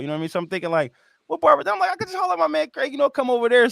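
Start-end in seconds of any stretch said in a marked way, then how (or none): 1.3-3.7 high, bright-eyed voice hitting on the answer; excited realization. Retold or imitated. Host recalling deciding to call his barber Craig, excited. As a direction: sound like the answer just clicked. Retold thought picking a barber.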